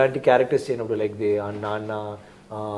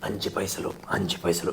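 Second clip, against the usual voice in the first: bassy voice; 6 semitones lower, 7 dB quieter, much faster than usual. Retold or imitated. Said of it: "So there is bass and thing".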